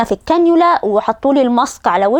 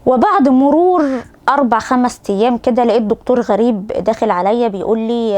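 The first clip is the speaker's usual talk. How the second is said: normal talking voice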